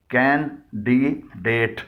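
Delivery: कैंडिडेट stretched slowly into three syllables. Shown as a demonstration. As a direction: break कैंडिडेट into slow beats. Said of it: "इसके भी तीन हिस्से हैं".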